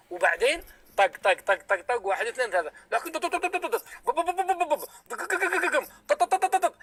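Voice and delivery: tapping noises for the interrupting; mimicking the hammering. Shown as a demonstration. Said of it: "كسرت لنا روسنا".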